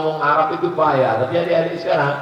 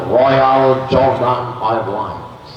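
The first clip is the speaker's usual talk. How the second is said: drawn out; 3 semitones lower, much slower than usual. Acted. Slow drawn-out airline name.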